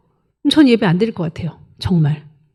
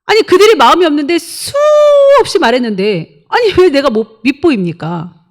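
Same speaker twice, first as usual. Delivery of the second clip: higher and louder, words pushed out; exasperated, repeating what was said so many times; frustrated raised preaching voice; exasperated outburst; 5 semitones higher, 4 dB louder than usual. Real, not acted.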